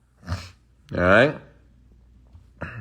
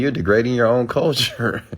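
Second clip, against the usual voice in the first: ordinary talk, nothing marked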